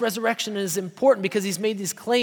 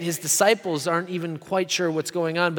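usual speaking voice

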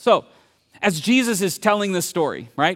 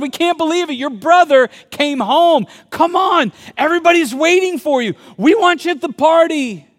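much higher, louder thrilled voices; 10 semitones higher, 8 dB louder than usual. Retold or imitated. Party guests urging the older brother to come in, thrilled. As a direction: squeal with delight and urge him in. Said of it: "everyone's thrilled".

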